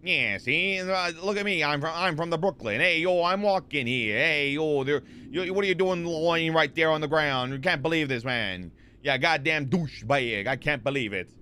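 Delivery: hammed-up Brooklyn tough-guy accent; mock Brooklyn accent. Streamer heckling lying lawyers as a Brooklynite, mock Brooklyn accent. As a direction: ham up a cartoon Brooklyn accent. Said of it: "Is that a Brooklyn accent?".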